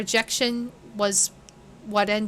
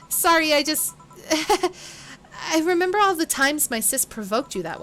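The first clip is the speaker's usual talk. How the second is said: laughing while talking; 6 semitones higher than usual. Acted. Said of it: "Are you laughing?".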